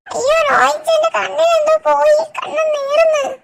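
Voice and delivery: put-on high-pitched girl's voice in distress; distressed girl character. Ami fussing to Roy over a stinging eye, high girlish whine.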